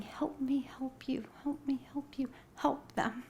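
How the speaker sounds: unvoiced, breathy whisper. Speaker echoing a divine voice in a hushed whisper. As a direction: breathe it out like a secret. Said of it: "I hear the whisper again".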